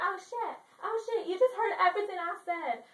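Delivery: put-on high, loud, startled girl; mock alarm. Girl on balcony realizes he heard everything, mock alarm. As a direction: blurt it in hammed-up panic. Juliet startled at being overheard.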